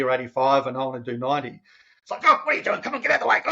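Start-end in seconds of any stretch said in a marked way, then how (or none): 2.2-3.4 much higher, exasperated mock rant; mock road rage. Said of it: "a little bit of road rage".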